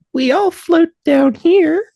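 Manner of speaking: high, slow sinister clown; creepy clown voice. Pretend. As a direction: lure them in like a sewer clown. Creepy quote from It.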